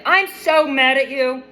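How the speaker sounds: overdramatic sulk; mock melodramatic anger. Asking listeners if they've been this mad, hammed-up sulky outburst. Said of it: "so mad that you're just like".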